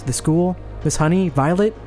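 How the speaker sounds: usual speaking voice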